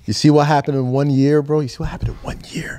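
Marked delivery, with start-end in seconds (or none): 1.6-2.8 hushed unvoiced whisper, a bit fast. Real, not acted. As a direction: whisper it like sharing a secret. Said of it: "Why are you whispering?".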